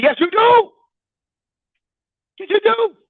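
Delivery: high teasing Yes, you do; teasing retort. Pretend. Preacher teasing a listener who denies judging, high. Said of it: "[name], I don't judge people".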